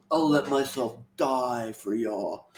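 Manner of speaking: hushed, slow put-on heroine; Brooklyn. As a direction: lightly imitate a noble self-sacrifice, drawn out. Second mock take of Brooklyn's sacrifice.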